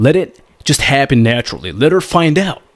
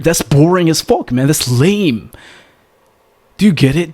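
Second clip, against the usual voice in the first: usual speaking voice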